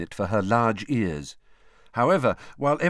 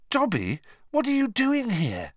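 amazed; 13 semitones higher than usual. A narrator's reading. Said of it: "Harry said in amazement".

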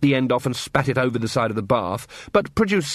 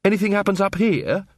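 higher, sharp and tart; 8 semitones higher than usual. A narrator's reading. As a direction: cut him down tartly. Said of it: "said Angua tartly".

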